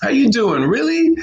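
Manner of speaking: high upbeat pal voice; American buddy chat. Retold or imitated. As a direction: bounce questions at a friend. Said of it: "how we talk in America".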